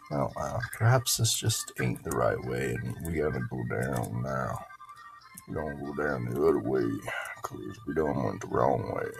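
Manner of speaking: low, soft country voice; low-pitched country-accent musing; put-on country accent. Put-on drawl while playing.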